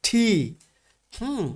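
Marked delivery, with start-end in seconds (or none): 0.1-0.3 ঠি with a strong puff on the t; careful model of ঠি; correct T pronunciation. Shown as a demonstration. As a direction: say ঠি as aspirated "thi", tongue stop then breath. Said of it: "এটার উচ্চারণটা কি হবে?".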